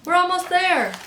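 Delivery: high, loud urgent call; anxious calling. A narrator's reading. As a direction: call encouragement anxiously over the storm. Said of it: "an anxious voice called".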